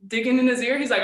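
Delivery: usual speaking voice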